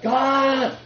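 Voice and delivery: high cry of God; yelled out. Retold or imitated. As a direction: cry out to God as the car crashes. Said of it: "I yelled out".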